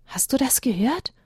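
hushed frightened whisper; breathy whisper in the dark wood. A narrator's reading. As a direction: whisper it, scared. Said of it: "flüsterte Elia".

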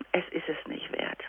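soft unvoiced whisper to herself; whispered self-talk. Shown as a demonstration. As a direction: whisper it to calm yourself down. (flüsternd zu sich selbst)

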